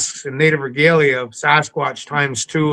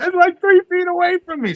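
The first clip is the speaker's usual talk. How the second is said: alarmed report. Retold or imitated. Adam on radio reporting Sasquatch.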